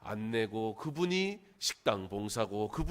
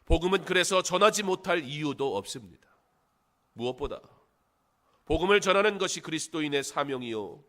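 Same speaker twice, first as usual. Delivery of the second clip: higher, louder proclamation; preaching; 4 semitones higher, 7 dB louder than usual. Acted. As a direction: drive the point home as a preacher. Preaches with raised voice. (힘있게 선포하는 설교 말투)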